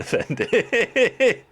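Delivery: slow words dissolving into high giggles; real giggles overtaking the line; breaking into laughter. Real, not acted. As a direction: start deliberately, then crack up on the last word. Breaks up laughing at own joke.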